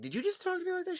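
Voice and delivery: high, indignant mom voice; scolding mom. Retold or imitated. Mom catches his sister snapping, high indignant scold. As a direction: mimic a mother catching attitude. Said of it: "My mom will constantly be like".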